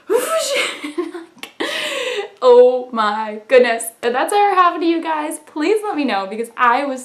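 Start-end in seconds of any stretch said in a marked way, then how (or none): 0.1-3.8 laughing. Retold or imitated. Laughing embarrassed self-quote.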